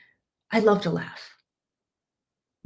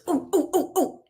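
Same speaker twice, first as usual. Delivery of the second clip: high, eager ooh sounds; eager ooh to cut in; 9 semitones higher, 3 dB quieter than usual. Shown as a demonstration. Coach showing how to break into a meeting eagerly. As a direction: sound like a keen student itching to jump in. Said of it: "you can go like this".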